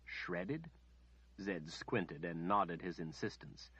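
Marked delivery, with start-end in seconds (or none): 0.0-0.7 softer, barely voiced incredulous "Shredded?"; just mouth "Shredded?" in disbelief; the healer mouthing "Shredded?" in disbelief. A narrator's reading. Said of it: "she incredulously mouthed".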